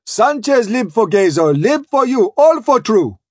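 high-pitched crewman's call in coast pidgin; bawling. Acted. Crewman recommending Sanchez to Spring, bawling. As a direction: bawl the news up to the captain. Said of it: "bawls the crew".

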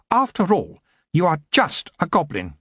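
cold, imperious queen voice, pitched high; haughty elf queen. A narrator's reading. Queen to goblin prisoner, imperious and cold. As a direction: speak down to him like a queen.

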